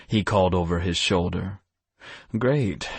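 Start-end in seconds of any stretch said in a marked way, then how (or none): 2.4-2.9 a higher sarcastic "Great", falling at the end; "Great" thrown out with mock cheer. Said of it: "I grumbled".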